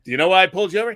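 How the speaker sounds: stern traffic cop, lightly played. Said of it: "everything the cop says".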